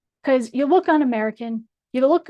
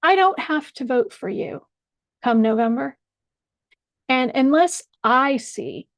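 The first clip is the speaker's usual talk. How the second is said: ordinary delivery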